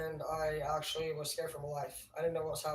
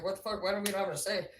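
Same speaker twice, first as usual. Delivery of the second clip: usual speaking voice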